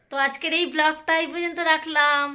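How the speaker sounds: ব্লগটা এই পর্যন্ত রাখলাম drawn out, each word slow and clear; slow down deliberately on the sign-off; said slowly. Shown as a demonstration. Vlogger to viewers, closing the vlog slowly and deliberately. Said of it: "এটা আস্তে আস্তে বলছি".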